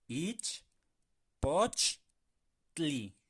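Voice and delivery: "Ich, poch, tli" con pausas, poch más fuerte; por sílabas. Shown as a demonstration. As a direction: separa cada sílaba. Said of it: "Bien importante que sea T L I". ("Ich" "poch" "tli" separados y claros)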